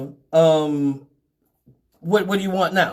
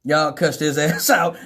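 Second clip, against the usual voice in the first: ordinary delivery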